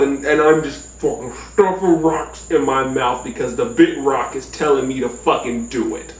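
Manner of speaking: normal talking voice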